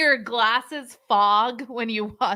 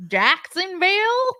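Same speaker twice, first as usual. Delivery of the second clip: higher-pitched, hammed-up Southern twang; Southern drawl; 9 semitones higher than usual. Retelling Floridians naming their hometown, mock Southern drawl. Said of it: "southern drawl".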